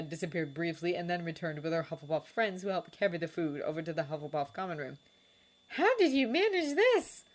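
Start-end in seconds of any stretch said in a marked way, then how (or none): 5.7-7.2 high, slow amazed question; marveling girl. A narrator's reading. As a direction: gasp at the feast. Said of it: "one of the girls marveled".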